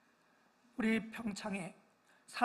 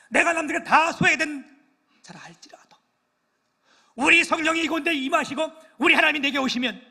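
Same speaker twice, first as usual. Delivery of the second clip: high, loud fervent sermon climax, with sudden hushed drops; preaching at full force; 7 semitones higher, 14 dB louder than usual. Real, not acted. Pastor rousing his congregation toward the Lord, in a loud fervent preach. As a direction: thunder the hope out to the pews.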